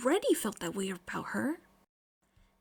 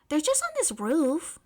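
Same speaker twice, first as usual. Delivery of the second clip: arguing; 5 semitones higher than usual. A narrator's reading. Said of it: "May argued".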